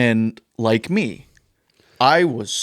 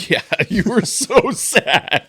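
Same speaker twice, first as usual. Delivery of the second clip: slow, high tease through laughter; laughing; 9 semitones higher, slower than usual. Real, not acted. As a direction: crack up while teasing a friend. Teasing friend while cracking up.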